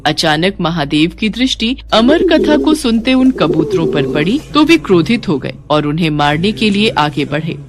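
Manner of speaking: usual speaking voice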